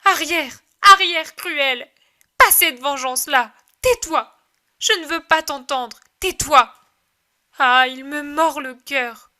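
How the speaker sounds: mother shrieking at Satan in torment; screaming. Acted. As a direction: cry out, driving the vision away. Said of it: "Et elle crie".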